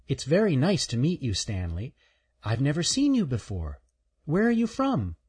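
a curious squirrel greeting a newcomer; friendly little squirrel. Acted. Shelby the squirrel speaks.

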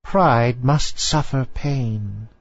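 put-on very slow old lady voice; stern old lady. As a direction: lay it down slowly like a stern grandmother. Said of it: "replied the old lady".

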